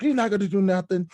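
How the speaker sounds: loud, high mock back-talk; defiant kid. Recalling how he talked back to his mother, loud high defiant retort. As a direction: fire it back like a cocky boy daring his mom.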